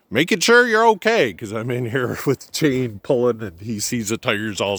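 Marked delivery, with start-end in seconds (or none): 0.1-1.3 imitating a friendly driver, pitched higher; higher mock voice of a concerned neighbour; passing driver. A driver checking on the stuck truck, friendly and higher. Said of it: "He's like".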